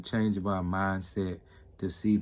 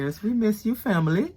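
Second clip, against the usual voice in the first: excited shout-out; 14 semitones higher, 8 dB louder than usual. Real, not acted. Shout-out to friends abroad.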